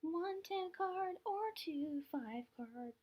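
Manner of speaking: high, slow, strained voice; awkward voice. Real, not acted. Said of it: "My voice sounded awful then".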